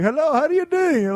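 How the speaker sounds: high-pitched, put-on Indian accent; hammed-up Indian accent, pitched well up. Shown as a demonstration. Host failing at his old voice, fake Indian accent. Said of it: "putting on an Indian accent".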